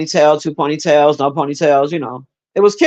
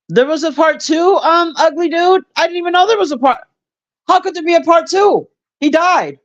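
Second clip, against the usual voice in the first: much higher, incredulous, half laughing at the news; high disbelief; 11 semitones higher than usual. Real, not acted. Streamer learning of a sequel, high incredulous. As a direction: sound like you can't believe a sequel exists.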